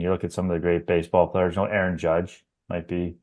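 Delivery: usual speaking voice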